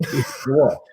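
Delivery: normal talking voice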